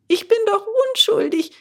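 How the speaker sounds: put-on high, loud, desperate boy; pleading innocence. Bengele pleads his innocence to the gendarmes.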